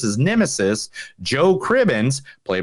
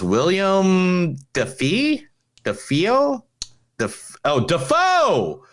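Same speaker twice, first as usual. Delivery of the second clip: rising excitement, pitch climbing higher; getting more excited with each Dafoe; 6 semitones higher, much slower than usual. Real, not acted. Excited realisation of actor name.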